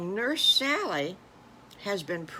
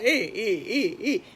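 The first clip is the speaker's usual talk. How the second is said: piglets crying; 3 semitones higher, 5 dB louder than usual. Acted. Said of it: "they're starting to cry".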